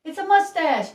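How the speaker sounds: put-on encouraging guesses to a child; eager guessing. Shown as a demonstration. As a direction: cheer on a little kid. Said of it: "you go".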